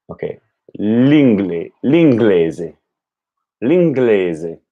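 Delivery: l'inglese, GLEH pure eh; repeating Italian. Shown as a demonstration. Learner working on the vowel, careful l'inglese. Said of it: "like the vowel in day, but without the glide".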